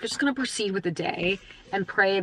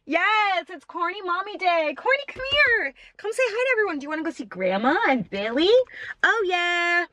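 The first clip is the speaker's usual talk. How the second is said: high sing-song pet voice; high doggy talk; 10 semitones higher than usual. Real, not acted. Baby voice talking to dog.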